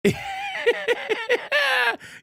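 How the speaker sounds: real burst of high laughter. Real, not acted. Host laughing at a viewer's comment, high laugh.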